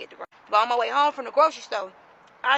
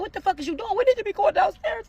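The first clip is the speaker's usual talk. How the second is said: high-pitched panicky pleading, not shouted; retold panic; 10 semitones higher than usual. Woman urging her man downstairs during raid, acted panic. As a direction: plead urgently, acting out panic. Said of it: "Like, I'm panicking".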